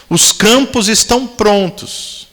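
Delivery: devagar e mais agudo, acento em campos; pregação enfática. Acted. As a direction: pregue pesando cada palavra. (campos destacado, lento, em tom de pregação)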